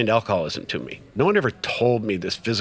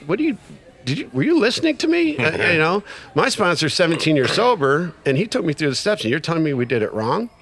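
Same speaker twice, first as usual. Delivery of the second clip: indignant retort, pitched much higher; pissed off; 7 semitones higher, 3 dB louder than usual. Retold or imitated. Newcomer challenges the guy who faulted his step work, pissed off. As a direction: fire back in rising, offended disbelief. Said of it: "And I'm getting pissed".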